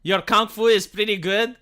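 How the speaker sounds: ordinary delivery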